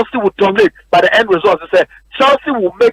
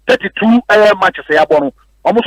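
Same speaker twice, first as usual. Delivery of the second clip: ordinary talk, nothing marked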